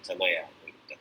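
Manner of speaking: low, soft and resigned; calmed down. Retold or imitated. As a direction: back off quietly, letting it drop. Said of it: "फिर मैं शांत हो जाता हूँ". (शांत होकर, धीमी और नीची आवाज़ में)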